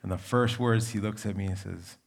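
usual speaking voice

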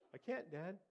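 imitated childish plea; pleading kid. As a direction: protest to dad like a hurt child. Hurt boy answering his father.

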